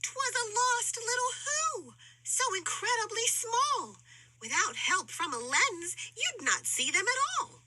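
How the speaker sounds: high, gentle storybook reading; storytelling voice. A narrator's reading. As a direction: read it like a bedtime tale. Narration in tiny voice.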